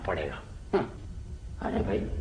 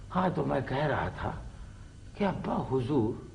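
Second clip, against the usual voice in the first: normal talking voice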